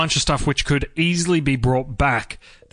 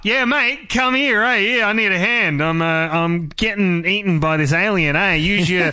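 ocker Australian. Pretend. Australian companion calling the Doctor for help, broad ocker accent. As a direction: hail the Doctor like a laid-back Aussie bloke. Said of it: "Did they talk like that?".

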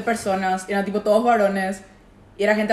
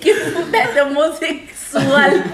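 laughing mock insult; 5 semitones higher, 6 dB louder, much slower than usual.

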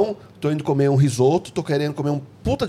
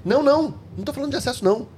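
high emphatic denial; 9 semitones higher, faster than usual. Real, not acted. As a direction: pitch jumps up, insisting. Insisting correction.

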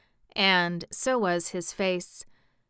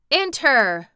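loud, higher commanding call; crying out; 6 semitones higher, 7 dB louder than usual. A narrator's reading. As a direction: call it out loudly from within the rock. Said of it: "and a voice cried".

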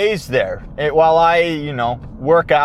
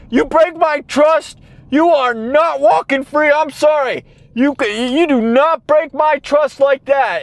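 stern scolding; 9 semitones higher than usual. Retold or imitated. Scolding the dog over trust.

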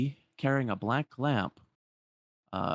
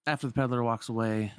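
plain reading voice

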